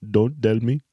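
Indian accent demo. Shown as a demonstration. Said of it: "how to do an Indian accent".

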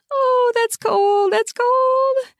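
very high, slow squeal; squealing from cold. Acted. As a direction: stretch the words out in a high cold shock. Reacting to the cold can.

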